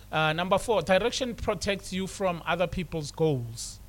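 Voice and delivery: ordinary talk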